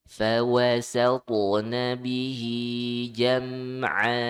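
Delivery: loud melodic tajwid chant; Quran recitation. Shown as a demonstration. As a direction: recite it out full for the class to copy.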